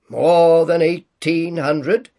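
slow, loud booming giant spirit; echoing ethereal energy being. A narrator's reading. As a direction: boom it out like a jolly giant. Ghost answers.

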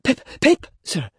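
put-on very high, panicked boy's cry; frightened, speaking up. A narrator's reading. Boy repeats his name on the convict's order, high frightened cry. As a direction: pipe up urgently as a scared child. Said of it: "Give it mouth!".

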